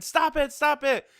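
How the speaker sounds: high mock yell of stop it; imitated scream. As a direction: pitch a pleading cry way up. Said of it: "Goku is screaming".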